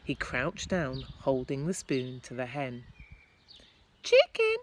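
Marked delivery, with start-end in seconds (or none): 4.0-4.6 high, loud coaxing call; boy calling a hen over; coaxing chicken. Acted. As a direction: lure the hen. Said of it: "he said".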